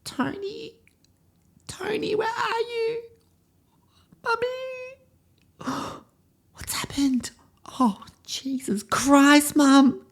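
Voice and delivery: very high, mostly whispered, hammed-up panic; mock horror in a tiny girl's voice; distraught little girl. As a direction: gasp out a child's shocked disbelief. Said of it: "suddenly we go into the emotion".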